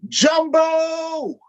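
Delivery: high, loud cartoon yell. Donkey yelling at Elephant, high and loud. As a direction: shout the insult out. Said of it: "Donkey yells out".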